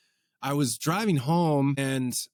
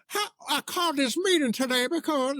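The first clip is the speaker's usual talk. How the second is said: high chicken-like singer voice; 13 semitones higher than usual. Retold or imitated. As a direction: bounce through it way up high, half sung. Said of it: "he's like a chicken".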